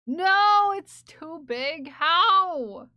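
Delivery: loud, high startled yelp of dismay; real shocked shout; shocked outcry. Real, not acted. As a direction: cry out at the huge image. Reacting to oversized emote.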